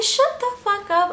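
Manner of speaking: high incredulous snap; mock snap. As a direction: shut him down in disbelief. Snapping at Jose.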